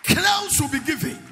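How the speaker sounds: crowns will be given, slow and ringing; preaching crowns. Real, not acted. Preacher to congregation, slow ringing proclamation. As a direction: proclaim the promise from the pulpit. Repeats verse with full force.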